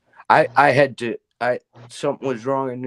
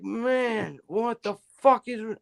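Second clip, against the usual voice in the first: high, slow bewildered exclamation; drawn out in disbelief, much higher; retold amazement at the toilet; 11 semitones higher, much slower than usual. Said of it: "I was like".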